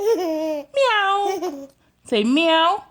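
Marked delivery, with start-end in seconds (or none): 0.0-1.7 cat sound. Pretend.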